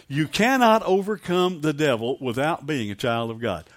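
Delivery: usual speaking voice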